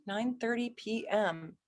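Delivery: checking it over quietly to herself; murmured readback. Real, not acted. Rechecking the birth time while typing it in, murmured softly.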